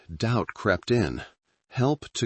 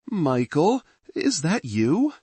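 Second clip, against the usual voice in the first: ghostly woman voice; 9 semitones higher than usual. Acted. Dead wife's voice on phone.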